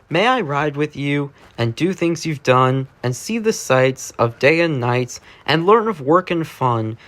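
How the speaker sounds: ordinary delivery, nothing marked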